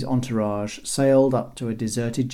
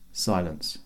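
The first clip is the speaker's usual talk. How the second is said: plain reading voice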